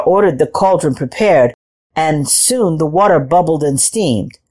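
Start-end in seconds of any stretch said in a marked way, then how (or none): none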